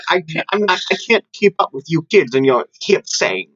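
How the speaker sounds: put-on flustered old man. Teasing the kids about their slang, put-on old man voice. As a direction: play an old man who can't keep up. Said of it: "I just wanted to use my old man voice".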